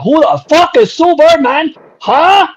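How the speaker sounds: high, loud, indignant imitation; mock yell ending on a sharp high question; yelling old Chinese guy. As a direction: yell it in high-pitched disbelief. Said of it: "just some old Chinese dude".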